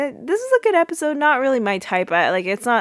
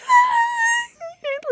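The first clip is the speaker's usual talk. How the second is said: long, very high-pitched squealed scream; mock scream; 4 dB louder than usual. Retelling Claire screaming into the pillow, mock scream. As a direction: imitate a panicked scream at the top of the range. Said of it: "she's, like, screaming".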